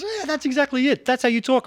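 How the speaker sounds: high, bright imitation of a mate; lightly voiced excited friend; imitating a friend. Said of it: "He goes".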